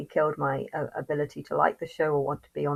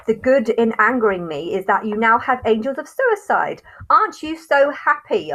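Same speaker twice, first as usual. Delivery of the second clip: loud, rising higher taunting; bitter sarcasm; 5 semitones higher, 7 dB louder than usual. Real, not acted. Vlogger taunting viewers about her angels, loud bitter sarcasm. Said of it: "I feel so angry".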